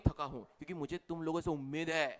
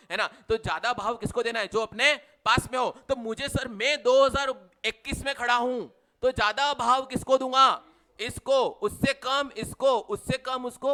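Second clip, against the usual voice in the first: emphatic loud teaching; 7 semitones higher, 11 dB louder than usual. Real, not acted. Teacher hammering point to class.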